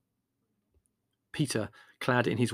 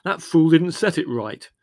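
loud, slow, higher-pitched blame; irritable; 6 semitones higher, 9 dB louder than usual. A narrator's reading. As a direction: snap it out loudly, laying the blame. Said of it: "Leslie snapped irritably".

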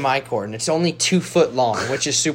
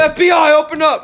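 high, loud pretend police yell; mock FBI raid shout; 11 semitones higher, 4 dB louder than usual. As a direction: burst in like a fake raid.

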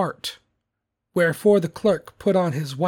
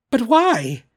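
high, loud, baffled question; cried out; 7 semitones higher, 6 dB louder than usual. A narrator's reading. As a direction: cry it out in bewilderment. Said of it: "cried Scrooge's nephew".

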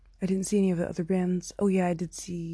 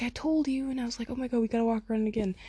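higher-pitched, excited imitation; excited retelling; 6 semitones higher, faster than usual. Friend and speaker spot him outside the buses, excited. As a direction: act out the excited back-and-forth.

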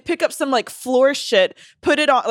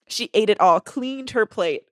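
ordinary delivery

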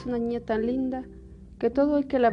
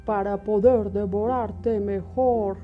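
menacing wolf voice; 5 dB louder, slower than usual. Acted. El lobo amenaza a Caperucita.

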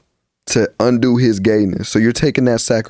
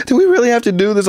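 mom's weary complaint; 12 semitones higher, faster than usual. Retold or imitated. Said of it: "She's like".

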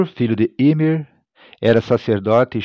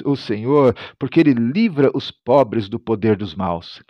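ordinary talk, nothing marked

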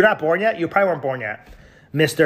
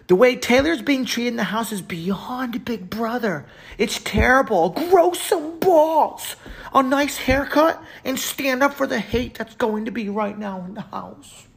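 much higher put-on voice, wailing on grow some balls; mocking whiny voice; 9 semitones higher than usual. Streamer mocking an upset fan's comment in a high whiny voice. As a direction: whine it out like a hysterical fan. Mock-reading angry commenter.

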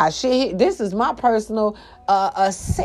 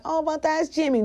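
mock naive excuse; 9 semitones higher, 4 dB quieter than usual. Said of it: "don't be like".

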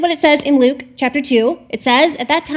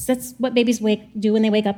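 ordinary delivery

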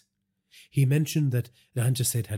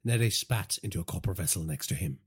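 usual speaking voice

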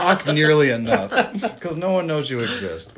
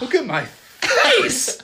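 slow, high, laughing melodrama; overacted movie scene; 12 semitones higher, 4 dB louder, much slower than usual. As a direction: drag out the villain's lament. Acting scarred villain scene.

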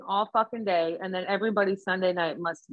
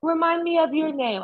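high-pitched mock Jamaican accent; pitched-up Jamaican-accented client; 8 semitones higher than usual. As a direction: imitate the client asking in a Jamaican lilt. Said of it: "it sounds like a Jamaican accent".

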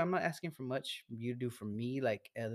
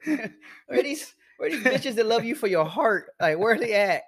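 high, loud mock rant; 9 semitones higher, 9 dB louder than usual. Friend ranting to his podcast cohost, high and loud. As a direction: voice jumps up, loud and laughing.

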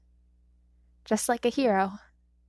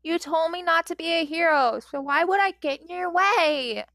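put-on higher, louder little boy; childish plea; 7 semitones higher, 6 dB louder than usual. A narrator's reading. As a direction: reason with him like a puzzled kid. Izuku child voice.

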